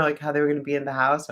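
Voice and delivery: ordinary delivery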